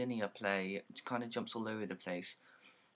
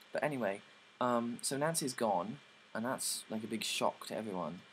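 ordinary talk

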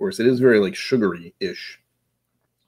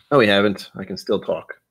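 normal talking voice

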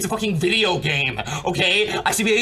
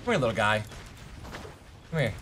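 softer, low, cooing call; coaxing; 7 semitones lower, 9 dB quieter, faster than usual. Acted. Streamer softly coaxing a little in-game creature. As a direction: lure the little guy in gently.

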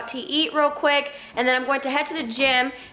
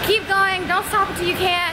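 coaching push; 6 semitones higher than usual. Retold or imitated. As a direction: push her through the last reps. Coaching on last set.

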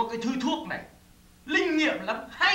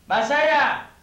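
loud, drawn-out call for someone missing; 5 dB louder, slower than usual. Acted. Man calls for the missing Bà Say, calling out. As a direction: call out through the house, louder each time. Calling an absent woman repeatedly.

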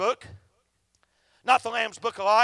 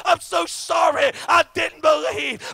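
lost father's farewell; 4 semitones higher, 6 dB louder than usual. Retold or imitated. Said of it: "their daddy looking back at them saying".

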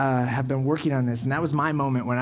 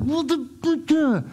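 high spluttering outburst that sags into deflation; upset, flustered; 9 semitones higher than usual. Acted. Dad explaining to his wife why he's upset, spluttering, then deflating. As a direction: splutter high, pause, then drop sheepishly at the end. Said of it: "why I'm so upset".

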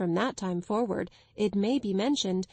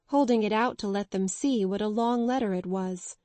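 ordinary delivery, nothing marked

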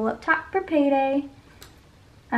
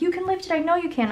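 higher-pitched quote of dad; retold pep talk, pitched up; imitating dad's encouragement; 7 semitones higher, faster than usual. Dad urging the self-declared weakling to lift, higher-pitched encouragement.

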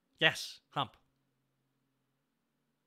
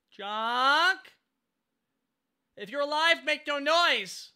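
calling out; 9 semitones higher, 6 dB louder, much slower than usual. Acted. Calling out to character.

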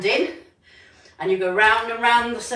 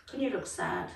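normal talking voice

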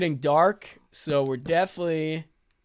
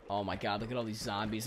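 1 hushed dread; 8 semitones lower, 11 dB quieter than usual. Real, not acted. Sees a horde of zombies.

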